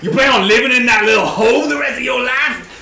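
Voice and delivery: high, loud mocking tirade; hammed-up shouted scorn; scornful adventurer. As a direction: jeer at the cornered archer. Player mocks archer in character.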